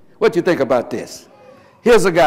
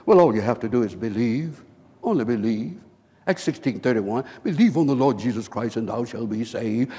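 low, soft quoted voice; understated mock preacher, pitched low; preacher quoting the devil's line; 5 semitones lower, 6 dB quieter than usual. The devil speaking through a preacher to a sinner, low and soft.

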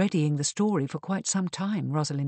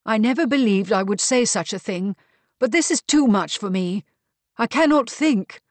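louder, higher protest; worked up and at a loss; overwhelmed; 5 semitones higher, 7 dB louder than usual. A narrator's reading. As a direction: protest that it is all too much. Old woman overwhelmed by the news.

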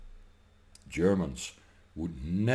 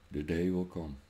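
much softer, lower menacing echo; quiet threat; 6 semitones lower, 10 dB quieter than usual. Real, not acted. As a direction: drop the voice to a low threat. Repeated threat to politicians.

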